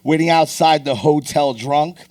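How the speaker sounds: performed poetry recitation; spoken-word poem. Acted. Recites a toast poem on stage.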